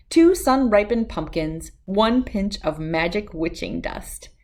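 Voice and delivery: usual speaking voice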